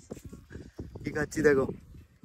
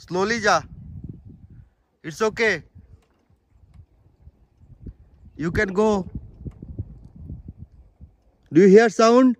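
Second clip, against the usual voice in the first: loud encouraging calls across the bridge; calling out; 8 semitones higher, 5 dB louder, much slower than usual. Real, not acted. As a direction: shout out so it carries.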